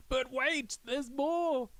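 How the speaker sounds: high, bright salesman hype; infomercial pitch. Acted. Hyping his own video like an infomercial host. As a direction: sell it like a late-night ad.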